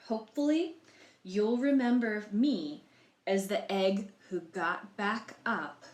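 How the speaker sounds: lively read-aloud in the egg's first person, slow on the struggle; story narration. A narrator's reading. Librarian reading Humpty Dumpty's story to children, storybook voice. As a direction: tell it like a storybook to young listeners.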